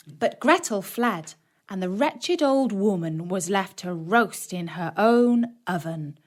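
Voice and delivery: ordinary delivery, nothing marked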